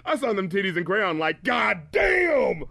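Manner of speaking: mock hyped shout. Podcaster mocking [name]'s crayon lyric, hammed-up shout. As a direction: belt the line like it's the best bar ever. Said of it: "So he says".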